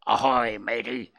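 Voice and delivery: pirate voice. Pretend. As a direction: ham it up like a pirate. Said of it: "Give me a chance to go".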